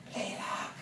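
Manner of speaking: hushed, frightened whisper in the dark; whispered plea from a dark bedroom; scared whisper. Retold or imitated. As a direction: breathe it out, too scared to speak aloud.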